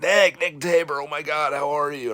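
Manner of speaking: put-on high, loud, eager hello to an old classmate; excited greeting. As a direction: call out his name in bright surprise. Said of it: "He's like".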